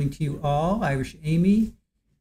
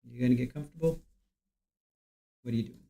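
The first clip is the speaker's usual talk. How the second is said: soft pet talk; 5 semitones lower, 11 dB quieter, much faster than usual. Real, not acted. Talking to the cat.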